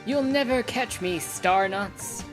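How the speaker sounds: loud, taunting stage villain; slow theatrical villain menace. Acted. Villain taunts heroes in play.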